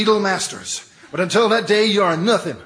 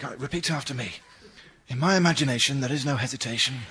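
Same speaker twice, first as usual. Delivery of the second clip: whispering; 6 semitones lower, 9 dB quieter, faster than usual. Acted. Whispered prompting on phone.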